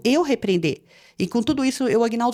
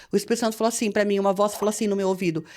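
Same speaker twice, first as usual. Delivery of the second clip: ordinary delivery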